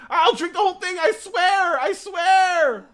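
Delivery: put-on whiny child begging for the juice; inner kid. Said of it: "Like the kid inside of you".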